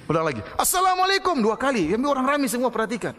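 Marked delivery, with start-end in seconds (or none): 0.6-1.4 loud salam shout. Retold or imitated. Preacher retells the policeman greeting an idol, shouting. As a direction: bellow the greeting at the statue to provoke. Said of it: "dia teriak keras".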